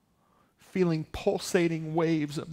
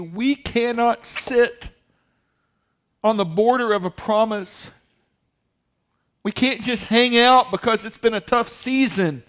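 full-force preaching; 6 semitones higher, 10 dB louder than usual. Acted. Pastor urging the church to possess the promise, at full force. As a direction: thunder it to the congregation.

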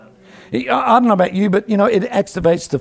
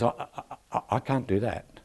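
softer, faltering "I, I, I" refusal; quiet hesitant refusal; 5 semitones lower, 12 dB quieter than usual. Retold or imitated. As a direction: back away quietly, stammering. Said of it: "He says".

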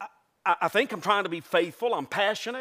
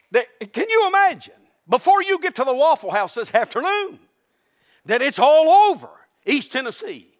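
mock outrage; 3 semitones higher, 6 dB louder than usual. Mock scandal report, shouted.

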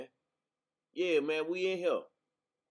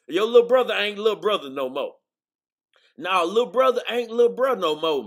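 louder, stressed LITTLE, amazed; amazed exclaiming; 4 semitones higher, 7 dB louder than usual. Real, not acted. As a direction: marvel at how big he got. Marvelling at nephew's size.

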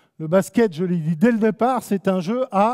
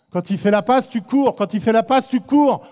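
rising louder each time, hit "passe" and "cours"; insistent repetition; 3 semitones higher, 6 dB louder than usual. Shown as a demonstration. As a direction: drill the rule into them, louder each time.

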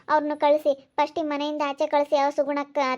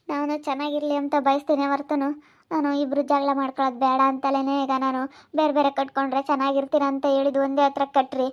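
normal talking voice

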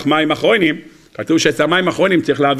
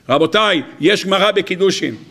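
usual speaking voice

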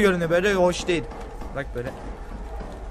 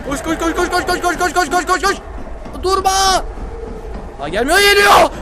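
frantic, higher, rapid urging; being chased; 9 semitones higher, 5 dB louder than usual. Real, not acted. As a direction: run for your life, panicking.

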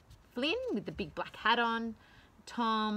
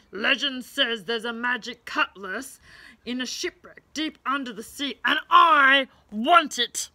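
mild greedy dinosaur growl, loud at the end; a hint of a roaring T-Rex; lightly voiced roaring T-Rex; 9 dB louder than usual. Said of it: "roared the pirate T-Rex".

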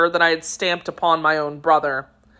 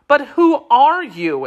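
high, raised-voice startled question; astonished exclamation; 8 semitones higher, 4 dB louder than usual. A narrator's reading. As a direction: demand it in alarmed amazement. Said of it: "exclaimed all the witnesses".

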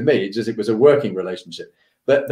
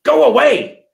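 high, louder mock outburst; exasperated dismissal; 13 semitones higher, 6 dB louder, slower than usual. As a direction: imitate a boss sending someone packing loudly. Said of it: "It wasn't hard".

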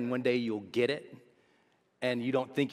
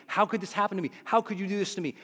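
mock anger; 8 semitones higher, faster than usual. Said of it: "You're so angry".

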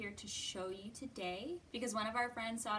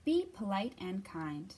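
expressive storybook reading voice; reading to children; 2 semitones higher than usual. Acted. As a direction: read it gently to little kids. Reads picture book to children.

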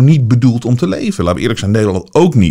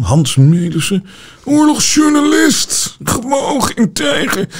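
much higher, slow, teary mock praise; mock whiny admirer; 11 semitones higher, slower than usual. Vlogger mocking the reporter's fans, higher teary mock gushing. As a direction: gush like a sobbing fan in a squeaky voice.

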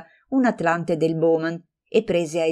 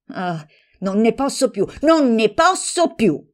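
breaking outburst; 6 semitones higher, 4 dB louder than usual. Acted. Exhausted friend at breaking point before storming out, screaming. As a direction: explode in exasperation. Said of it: "gridò".

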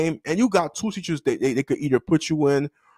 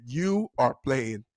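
slow, softer imitated teacher line; teacher's catchphrase; 3 semitones lower, 6 dB quieter, slower than usual. Teacher to student refusing handshake, slow imitated catchphrase. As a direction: space out each word as the teacher's catchphrase. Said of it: "That was his favorite word".

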